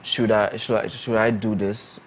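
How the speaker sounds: ordinary delivery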